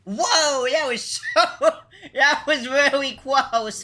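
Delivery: high, laughing exclamation; laughing relief. Real, not acted. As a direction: giggle through a narrow escape. Laughing after near miss.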